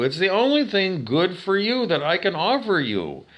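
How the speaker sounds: usual speaking voice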